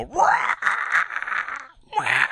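loud, high mock dinosaur roar; playful drawn-out monster screech. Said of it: "I act like I'm a dinosaur".

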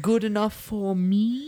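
lightly voiced movie character; character impression. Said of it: "And he says".

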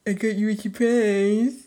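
slow, high teasing imitation of her partner; mocking partner voice. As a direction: drag out "surprise" like a tease. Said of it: "I don't sound like that".

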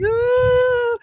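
very high, drawn-out fake cry; put-on pitiful wail; mock sad whine. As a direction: whimper up high like a creature playing sad. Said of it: "acting really sad".

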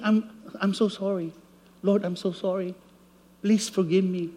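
quiet, contrite plea for forgiveness; soft apology. Retold or imitated. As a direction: murmur it like a guilty husband. Voicing a sorry prayer.

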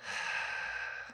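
soft, long exhale; yielding sigh. Acted. Man softening as partner strokes chest, yielding sigh. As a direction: breathe out and give in. Giving in to touch.

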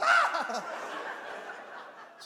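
breathless high laughter; high wheezy laugh. Real, not acted.

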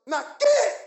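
sudden loud, high order to get; imitating a forceful dismissal; commanding shout. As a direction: order the dog off with full authority. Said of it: "That's how you gotta talk".

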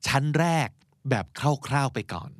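usual speaking voice

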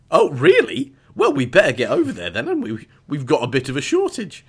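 high, loud eager alien; greedy cow hunter. Retold or imitated. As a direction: jump at it with greedy delight.

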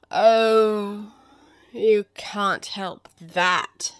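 loud drawn-out "Oh", then slow cat drawl; Cheshire Cat. Acted. The cat brushes off Alice's objection, languid. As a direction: hold the "Oh", then spread the words out.

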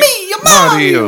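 squeaky cartoon Italian accent, very high and loud, through a laugh; a goofy Italian accent as Mario; mock Mario voice. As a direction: shriek it as a laughing Italian plumber.

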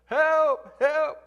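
high, loud cry for help; man dangling off the canyon, screaming up. Retold or imitated. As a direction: yell up from the cliff edge. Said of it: "And he screams up".

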